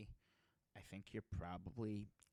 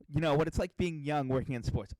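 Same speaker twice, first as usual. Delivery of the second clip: hammed-up upbeat podcast host, louder; mock podcast intro; 6 semitones higher, 10 dB louder than usual. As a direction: tease him by mimicking his opening line. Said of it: "Leads the podcast with".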